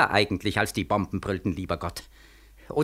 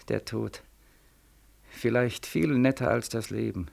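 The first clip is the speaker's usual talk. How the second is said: fading into death; 2 semitones lower, 8 dB quieter than usual. Acted. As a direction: fade out as if dying. Dying man drifting off.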